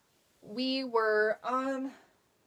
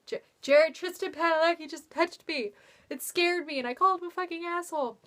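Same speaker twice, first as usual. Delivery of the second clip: higher, excited starstruck disbelief; higher, fast, breathless mortified excitement; mock stunned fan; 6 semitones higher than usual. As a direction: replay the giddy shock. Retold shock after meeting star.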